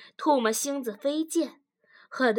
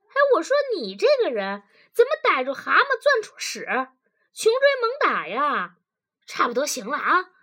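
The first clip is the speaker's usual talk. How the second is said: loud, fast, high exasperation; annoyed protest; 6 semitones higher, 7 dB louder, faster than usual. A narrator's reading. Husband objects to wife's pressing him, annoyed. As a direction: burst out high, then ease down. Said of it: "男子不悦道".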